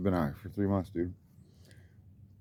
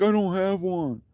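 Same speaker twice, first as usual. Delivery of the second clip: much higher, slow mock brush-off; imitating neighbor; 10 semitones higher, slower than usual. The neighbor turning him away, slow mock brush-off. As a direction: drag out a lazy refusal. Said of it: "He's like".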